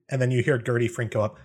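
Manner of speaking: normal talking voice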